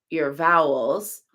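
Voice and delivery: ordinary talk, nothing marked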